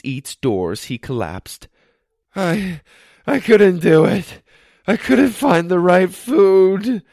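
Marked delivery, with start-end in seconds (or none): 2.3-7.0 higher, louder, slow panting plea; gasping after the long run; out of breath. A narrator's reading. Josh collapsing to the pizza worker, out of breath. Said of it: "he said out of breath".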